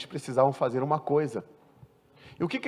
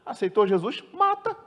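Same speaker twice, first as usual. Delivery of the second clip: high, mocking squeal on mata; mock outrage; 8 semitones higher than usual. Preacher mocks those who condemn new converts, mock squeal. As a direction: parody a harsh judgement, squeaking the last word.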